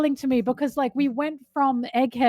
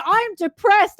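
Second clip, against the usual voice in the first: much higher, louder mock announcement; exaggerated character declaring a feeling; mock confession; 10 semitones higher, 5 dB louder, slower than usual. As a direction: announce it straight to the audience, hammed-up. Mocks expected confession to the audience.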